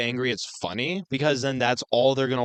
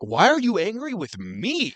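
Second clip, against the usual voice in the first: defensive parent; 7 semitones higher, slower than usual. Acted. Defensive parents acted by both hosts.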